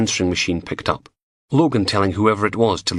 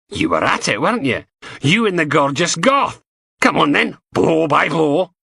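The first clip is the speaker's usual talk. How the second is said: higher, nosy and leering; teasing inspector; 9 semitones higher, 3 dB louder than usual. A narrator's reading. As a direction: prod him for gossip, grinning. Steele teasing about sex.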